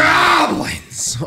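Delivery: hammed-up battle cry; mock scream. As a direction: bellow Goblins! like an obsessed hero. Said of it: "literally screams".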